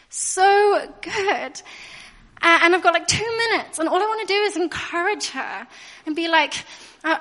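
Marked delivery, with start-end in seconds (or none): 0.1-1.6 laughing gush. Retold or imitated. Reacting to adoption news.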